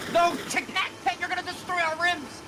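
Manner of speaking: high, frantic shouting; panicked yelling. Real, not acted. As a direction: yell in a panic.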